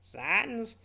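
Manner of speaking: high cartoon skeleton call; drawn-out high exclamation; Papyrus. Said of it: "I'm gonna do the papyrus voice".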